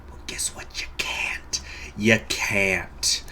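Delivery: breathy taunting whisper at the rival; mock menacing whisper. A player whispering a taunt at his rival. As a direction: whisper it like a sly threat.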